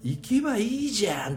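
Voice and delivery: hammed-up Tokyo slang; mock Tokyo "jan". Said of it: "下唇がニューって出てくる".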